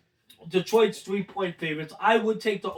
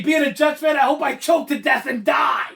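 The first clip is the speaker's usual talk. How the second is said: shouting in mock despair; loud rant; 6 semitones higher, 6 dB louder than usual. Real, not acted. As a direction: yell it like a fed-up fan. Jets fan venting, mock despair.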